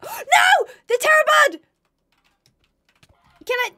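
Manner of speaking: gasp, then a high loud scream; terrified shriek. Real, not acted. Player spotting the Terrorbird near her dogs, shrieking. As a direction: shriek at the sight of the monster.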